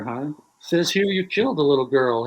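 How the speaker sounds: ordinary talk, nothing marked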